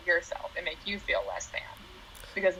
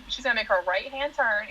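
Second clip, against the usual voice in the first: ordinary delivery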